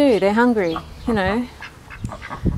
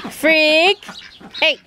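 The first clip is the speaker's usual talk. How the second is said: mock call; 7 semitones higher, 6 dB louder than usual. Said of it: "like, ritual of".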